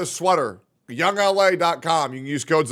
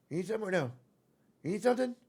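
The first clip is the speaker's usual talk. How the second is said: soft, high-pitched questioning; sweet pet talk; 4 semitones higher, 7 dB quieter, much faster than usual. Real, not acted. Owner checking what cat needs, soft high cooing. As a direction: ask tenderly, lifting the pitch.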